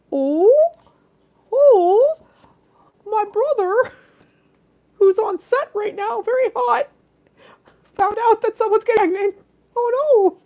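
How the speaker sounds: very high put-on voice; fretful story figure. Telling of a brother on set, high put-on fretful voice. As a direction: pitch it way up, like a worried little character.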